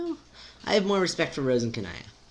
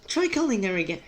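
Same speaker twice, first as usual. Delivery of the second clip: put-on high British-accented character voice; British accent, high opening; too-British voice; 8 semitones higher than usual. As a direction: overdo the British accent, pitched up. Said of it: "it's sounding too British".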